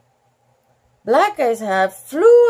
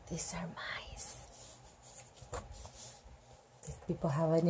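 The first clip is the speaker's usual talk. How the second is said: hushed whisper; 7 semitones lower, 20 dB quieter than usual. Real, not acted. Reader whispering a discovery about the picture. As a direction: whisper it like a secret.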